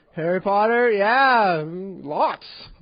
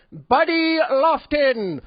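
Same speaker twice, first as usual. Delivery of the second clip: teacher calling roll; 7 semitones higher, 5 dB louder, much slower than usual. Retold or imitated. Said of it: "I will now call attendance".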